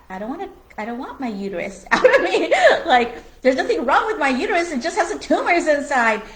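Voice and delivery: fast, loud, high, laughing objection; retold laughing refusal to the doctor; laughing protest. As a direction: insist with a laugh.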